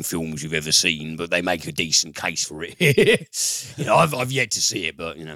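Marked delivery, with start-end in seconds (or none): 2.8-3.7 laugh. Real, not acted. Laughing about the film.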